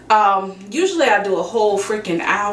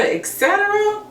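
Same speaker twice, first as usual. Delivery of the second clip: et cetera sung out much higher; high sing-song; 11 semitones higher than usual. Acted. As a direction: stretch et cetera up high, playful.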